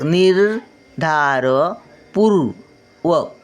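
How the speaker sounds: निर्धारपूर्वक very slowly, syllable by syllable; slow syllable reading. Shown as a demonstration. Grandmother sounding out निर्धारपूर्वक for children, very slowly. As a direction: sound out निर्धारपूर्वक at half speed. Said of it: "word then slow repeat".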